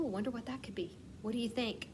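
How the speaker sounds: normal talking voice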